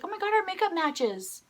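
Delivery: high-pitched imitated delight; delighted discovery. Singer noticing fan's matching makeup, high delight.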